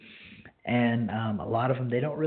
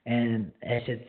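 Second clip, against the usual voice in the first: ordinary delivery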